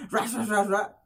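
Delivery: high gibberish mock rant; imitated garbled yelling. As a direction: mimic shouting that can't be understood. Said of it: "Pero escuché". (balbuceo agudo imitando gritos)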